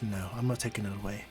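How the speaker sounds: calm her gently; soft reassurance. Acted. Izuku to Eri, soft and reassuring. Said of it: "Izuku goes".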